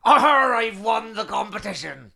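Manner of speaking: a loud, high, hammed-up pirate's growl on Arr, then a pirate accent. Reading out a listener's win as a pirate. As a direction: ham it up like a stage pirate claiming his prize. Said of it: "Can you d-, do anything about that?".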